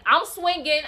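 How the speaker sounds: ordinary talk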